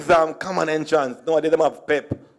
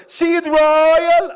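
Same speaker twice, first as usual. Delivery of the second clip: high, loud mocking remark; 12 semitones higher, 4 dB louder than usual. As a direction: squeal it like a gossip.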